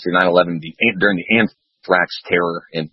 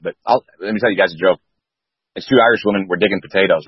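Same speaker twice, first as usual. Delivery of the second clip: ordinary talk, nothing marked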